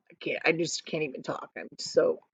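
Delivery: plain reading voice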